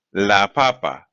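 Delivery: "papa" stressed on its first syllable; careful teacher's demo, "papa" stressed up front. Shown as a demonstration. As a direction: say "la papa" with the weight on PA-pa, falling after. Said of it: "Notice no accents".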